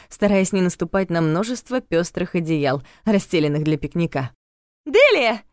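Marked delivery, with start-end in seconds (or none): 4.8-5.4 loud, high call of a name. A narrator's reading. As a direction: call across the meadow. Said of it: "крикнула Лили".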